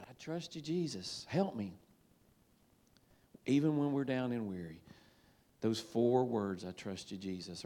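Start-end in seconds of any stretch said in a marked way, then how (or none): 0.0-1.8 soft prayer. Acted. Soft quoted prayer after joy. 6.6-7.6 soft prayer. Acted. Quiet quoted prayer when weary.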